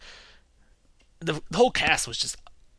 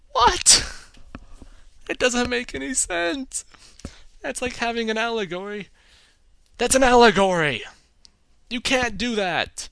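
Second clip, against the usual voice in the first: incredulous outburst; 8 semitones higher, 4 dB louder than usual. Pretend. Viewer protesting the allegory, higher in disbelief. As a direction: shriek the what, then plead it makes no sense.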